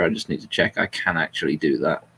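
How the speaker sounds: usual speaking voice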